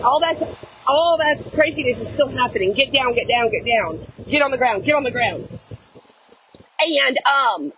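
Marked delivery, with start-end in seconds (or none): none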